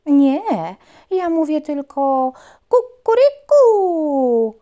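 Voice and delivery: loud, high, slow rooster boast. Acted. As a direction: crow it out like a proud rooster. Rooster answers.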